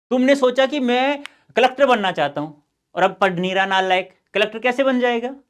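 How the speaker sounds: loud, high-pitched telling-off; mock scolding. Teacher to a student dreaming of being collector, scolding.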